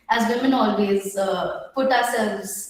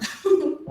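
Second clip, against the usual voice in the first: short embarrassed laugh; nervous laugh; 6 semitones higher than usual. Real, not acted. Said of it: "bit nervous".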